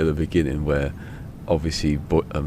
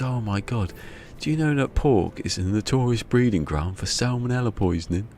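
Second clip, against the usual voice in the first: higher-pitched mock dismay; appalled; 7 semitones higher than usual. Vet to owners who fed dog pork, appalled. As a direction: lecture them in appalled disbelief.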